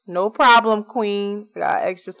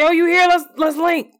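eager greeting; 8 semitones higher, 5 dB louder than usual. Retold or imitated. Retells greeting a friend in town.

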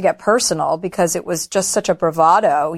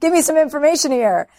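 higher-pitched, mock-desperate demand; retold impatient begging, pitched up; exasperated pleading; 7 semitones higher than usual. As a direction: plead with the therapist for an answer, pitch climbing. Retold demand to her therapist.

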